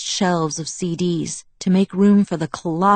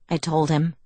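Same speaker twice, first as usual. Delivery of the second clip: ordinary delivery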